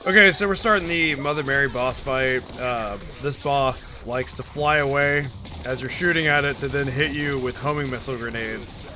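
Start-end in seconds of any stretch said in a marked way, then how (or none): none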